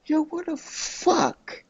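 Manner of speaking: high-pitched, drawn-out retold shock; retold disbelief. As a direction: stretch it out high in baffled disbelief. Acting out own shocked reaction.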